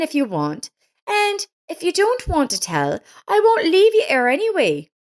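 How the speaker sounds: put-on high, bright-eyed concerned young woman's voice; kind neighbor Lisa. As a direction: play the caring neighbor, worried and eager to help. Lisa comforting Miranda.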